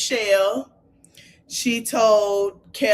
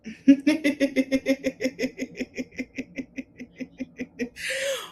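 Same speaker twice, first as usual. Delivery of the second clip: long laugh; 5 semitones higher, 4 dB quieter than usual. Real, not acted. Laughs at the recap.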